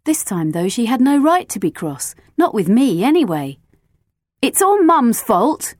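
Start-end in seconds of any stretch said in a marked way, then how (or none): 4.4-5.8 higher, slow, defensive protest. A narrator's reading. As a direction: blame it on Mum, defensively. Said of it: "I said defensively".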